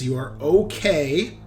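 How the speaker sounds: ordinary delivery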